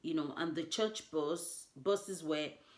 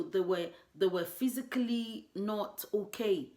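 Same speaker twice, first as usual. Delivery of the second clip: usual speaking voice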